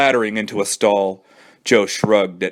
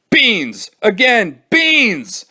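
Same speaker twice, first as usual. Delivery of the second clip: alarmed yelling; 12 semitones higher, 4 dB louder, much slower than usual. Acted.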